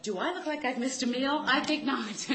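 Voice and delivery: high, loud, indignant sarcasm; sarcastic retort. Retold or imitated. Daughter silently answering bossy stepfather, sarcastic. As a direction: toss it back with mock offense.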